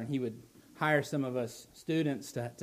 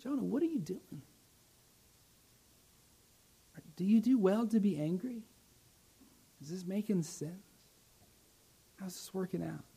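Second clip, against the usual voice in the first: softer, searching questions in God's voice; God's gentle rebuke; 4 semitones higher, 5 dB quieter than usual. Acted. God gently questions angry Jonah, soft and searching. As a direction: ask it softly, like a patient father. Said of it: "And God says".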